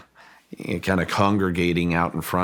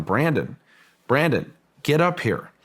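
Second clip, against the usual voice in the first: higher-pitched mock call from above; retold shout; 7 semitones higher than usual. Physicist calling the owner up the mesa, retold shout. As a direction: imitate shouting down, without real volume. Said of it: "start shouting down".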